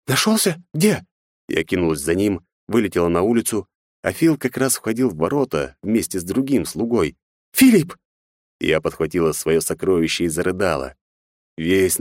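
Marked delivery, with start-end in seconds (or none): 0.1-1.1 high surprised gasp; hopeful disbelief. A narrator's reading. Mother hears son is found. 7.5-8.0 high loud cry of relief; relieved cry. A narrator's reading. Mother sees lost son.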